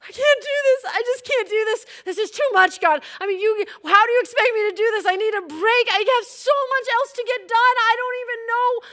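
loud, high-pitched wailing to God; hammed-up tearful outburst, high and frantic; crying meltdown. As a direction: wail it out as an overwhelmed plea. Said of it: "I start crying".

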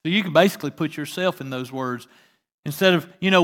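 ordinary delivery

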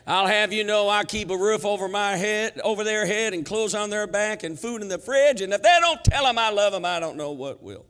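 loud, high, blustering Southern dad; hammed-up Southern indignation, easing at the end; southern accent. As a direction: rant defensively in a Southern accent. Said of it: "How come I get in this southern accent".

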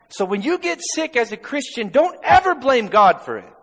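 high, loud, driving sermon; full-force preaching. Acted. Pastor preaching healing to the church at full force. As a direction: preach it with fire.

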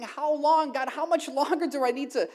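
a touch of desperate prayer; pleading with God. Retold or imitated. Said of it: "I found myself asking".

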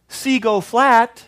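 high, drawn-out pleading; what Jesus didn't say, sung out high and slow; mock gentle plea. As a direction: coax the wind like a child.

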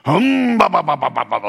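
loud high hum falling into ba ba syllables; playful humming. Retold or imitated. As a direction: hum a tune to break the ice. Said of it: "I just went ... like that".

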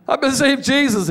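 high, loud and laughing; joyful announcement. Retold or imitated. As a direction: blurt out the news with delight. Announcing conversion to friend.

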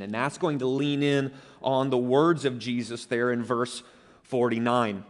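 ordinary talk

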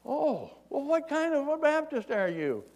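high-pitched acted holler; mock long-distance yell. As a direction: call the question up to a man high above. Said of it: "He yells up at him".

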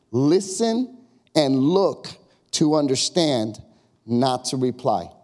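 "listen and look" drawn out, stressing "not"; slow emphatic. Shown as a demonstration. Preacher driving home the key point, slow. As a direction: lay each word down slowly.

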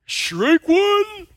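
high, loud umpire call. Retold or imitated. Umpire calling the strike, loud high call. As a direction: bark it like an umpire. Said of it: "said the umpire".

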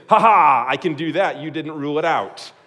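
loud mock gloating laugh. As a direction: taunt like a smug rule-breaker. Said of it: "and say".

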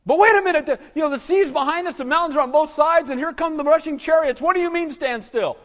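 acted alarm, voice shooting up; panicking. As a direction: panic as the chariots close in. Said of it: "And they're panicking".

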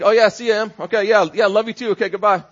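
husband hurrying past his wife with a distracted goodbye; dismissive brush-off. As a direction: rush her out the door. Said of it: "And eventually I'm like".

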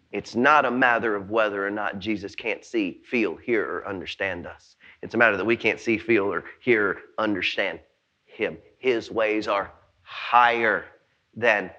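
very low, soft delivery, slowing at the end; quiet, deep close with weighted final words; hushed solemn preaching. Real, not acted. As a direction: bring the sermon down to a reverent hush.

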